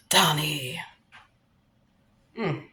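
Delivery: low, whispered sing-out to Donnie; teasing whisper. Pretend. Comedian taunting the absent Donald Trump, teasing whisper.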